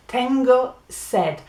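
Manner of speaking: Tengo sed said slowly and whole, with a lift on Tengo; careful teacher model of Tengo sed. Shown as a demonstration. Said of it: "ten, go".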